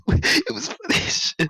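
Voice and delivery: high, laughing through the words. Real, not acted. Telling the story to a friend, laughing hard. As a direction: squeak it out mid-laugh. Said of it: "laughs Oh my God".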